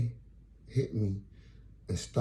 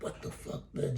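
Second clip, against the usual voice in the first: voiceless, stunned mock whisper; hushed aside of disbelief; 8 dB quieter than usual. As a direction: whisper it as a baffled reaction. Stunned reaction to rude treatment.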